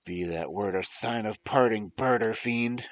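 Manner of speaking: startled shriek at the raven. A narrator's reading. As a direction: shriek it at the bird. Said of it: "I shrieked him starting".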